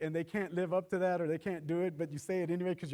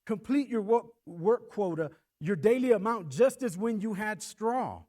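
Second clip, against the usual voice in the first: louder, stern taskmaster order, slowing at the end; harsh command; 4 semitones higher, 6 dB louder than usual. Retold or imitated. Preacher reading the taskmasters' harsh demand. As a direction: press them hard with the order. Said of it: "The taskmasters pressed them, saying".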